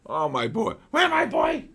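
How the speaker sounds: high sing-song voice for a beloved dog; pet talk. Real, not acted. Baby talk to his dog.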